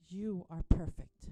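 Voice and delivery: loud, stress on You, said firmly; emphatic declaration. Shown as a demonstration. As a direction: declare You are perfect for the kids to copy. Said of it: "say to any dad that is around".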